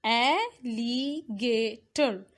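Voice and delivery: alligator slowly as al, li, ga, tor; syllable by syllable. Shown as a demonstration. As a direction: break alligator into four beats. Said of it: "divide each word given below into the syllables".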